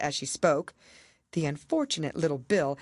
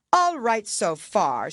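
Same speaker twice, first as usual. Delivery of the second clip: higher, louder royal pronouncement; pompous king; 6 semitones higher, 6 dB louder, slower than usual. A narrator's reading. King explaining the handwriting to the jury, pompous. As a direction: declare it like a self-important king.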